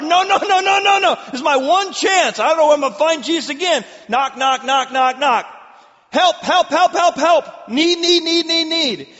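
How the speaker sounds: high, loud pleading shout; desperate crying out. Acted. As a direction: beg at the top of your voice. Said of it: "He cries out all the more".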